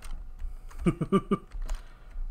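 breathy laugh. Real, not acted. Laughs after hello calls.

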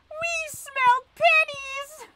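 very high, squeaky cry; meme voice. Pretend. Streamer quotes a meme as penny-hungry goblins, squeaky meme voice. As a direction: squeal it out, loud on the last word. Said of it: "Does anybody else know that meme?".

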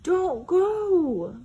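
high, loud pleading wail. Acted. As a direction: beg him to stay. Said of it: "wailed Bear".

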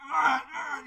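high, loud mock growl; cowboy grunting as he pushes the car. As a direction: grunt like a straining driver. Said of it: "he's like".

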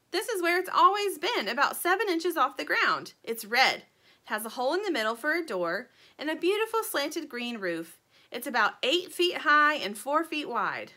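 lightly voiced little bird, lifted and lively; earnest little bird. A narrator's reading. Little bird describing her missing house, lightly voiced and earnest.

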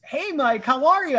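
ordinary talk, nothing marked

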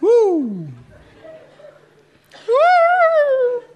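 excited cry; cheering woo. Real, not acted. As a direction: whoop with the crowd. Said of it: "crowd cheering".

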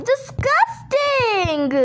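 high, drawn-out revulsion; exaggerated disgust. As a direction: stretch it out in hammed-up disgust. Reacts with disgust.

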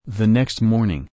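ordinary delivery, nothing marked

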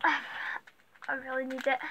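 usual speaking voice

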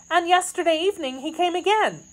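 high, quick maid's voice; anxious maid. Acted. Kitty telling of yesterday's visit, high and anxious.

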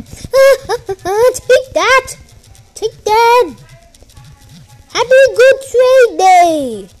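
high, loud gleeful taunting; gloating triumph. Real, not acted. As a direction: crow over the annoyed opponent. Gleefully annoying the other player.

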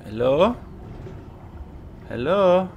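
normal talking voice

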